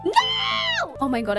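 usual speaking voice

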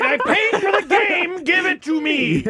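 lightly voiced old man complaining; grumpy old man. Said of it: "why do I sound like an old man going".

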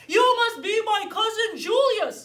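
booming high, loud storybook bear; big bear voice. Acted. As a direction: greet him like a long-lost cousin. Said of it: "said the bear".

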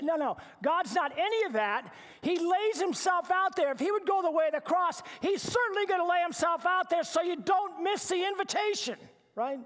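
high, loud, ringing declaration; full-force preaching. Real, not acted. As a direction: preach it out so no one misses it.

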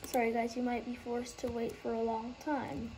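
usual speaking voice

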